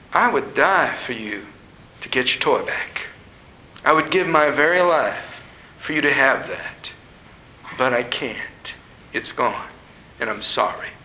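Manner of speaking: low, hushed, tender reassurance; quiet, sorrowful apology in a low voice; tender divine voice. Retold or imitated. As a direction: speak gently and low, with long pauses. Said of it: "And then this voice came".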